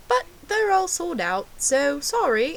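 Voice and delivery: high, loud imitation of mum in British accent; mock-apologetic mother. Mother's bad news acted out.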